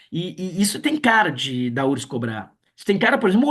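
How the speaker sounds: ordinary talk, nothing marked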